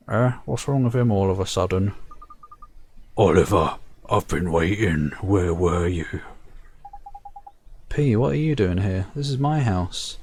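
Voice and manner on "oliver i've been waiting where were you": low slow voice. Acted. Reads P's lines in game.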